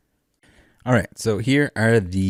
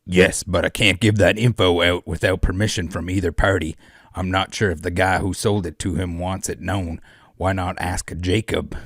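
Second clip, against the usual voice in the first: evil cowboy. Acted. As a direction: answer as a hammy cowboy villain. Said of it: "the evil cowboy".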